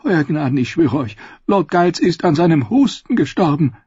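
lightly voiced anxious old Pycelle; a nervous old scholar defending himself; hint of a frail, anxious old man; old maester. Old maester pleads innocence.

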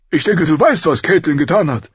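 forceful royal reproach; stern king. A narrator's reading. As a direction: confront him, pitched well up. King confronts his friend.

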